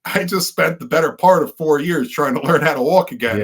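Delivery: breaking into a laugh while telling it; rueful laugh carrying the whole line. Real, not acted.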